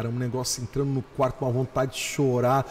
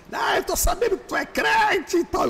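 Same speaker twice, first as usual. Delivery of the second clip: high-pitched mocking taunt; zoação de amigo. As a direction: imitate a teasing friend in a much higher voice. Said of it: "começou a me zoar pelo telefone". (zoando com voz bem aguda)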